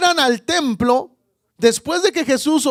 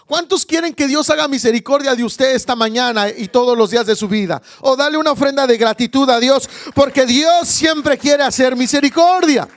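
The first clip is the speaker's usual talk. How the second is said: raised, fervent preacher's voice building louder; full-force preaching; 4 dB louder than usual. Real, not acted. Preacher urging the congregation to thank God, full-force preaching. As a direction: drive it up to a rousing, loud finish.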